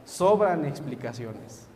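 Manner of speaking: quiet quoted ending. Acted. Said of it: "termina diciendo".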